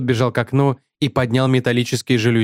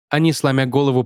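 ordinary delivery

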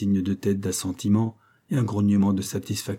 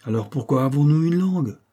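put-on quick, higher, louder child's question; lively retort; 6 semitones higher, 4 dB louder than usual. Jessie answering her mother's rule, lively and pert. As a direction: snap back brightly, a little cheeky. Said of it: "demanda Jessie avec vivacité".

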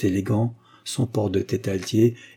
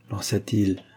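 plain reading voice

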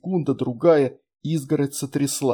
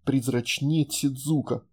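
plain reading voice

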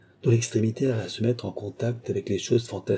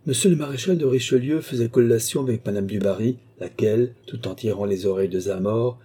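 plain reading voice

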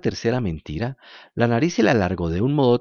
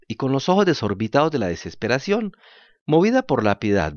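ordinary delivery, nothing marked

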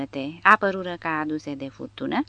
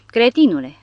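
loud, higher angry rebuke; scolding; 6 semitones higher, 9 dB louder than usual. A narrator's reading. Porta berating the Norwegian professor, loud angry rebuke. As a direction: snap it at him like an insult. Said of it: "îl apostrofă Porta".

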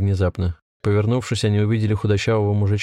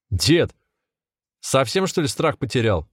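higher-pitched, mildly agitated protest; nervous shout; 4 semitones higher, slower than usual. A narrator's reading. Volodya protests through the locked door, mild nervous shout. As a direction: challenge him in a nervy, restrained shout. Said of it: "нервно закричал Володя".

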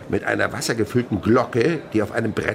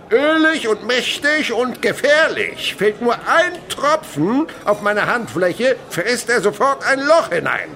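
menacing showman; 13 semitones higher, 7 dB louder than usual. Acted. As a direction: make the danger sound thrilling. Chemist dramatizing dangerous acid.

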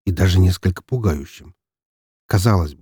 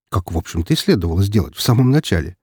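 usual speaking voice

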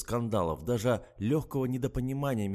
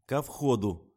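angry; 4 dB louder, slower than usual. A narrator's reading. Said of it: "вновь обозлилась девица".

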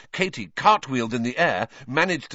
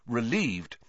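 ordinary delivery

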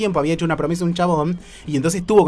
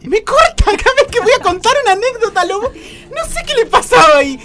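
mock indignant; 7 dB louder than usual. Mock complaint, laughing.

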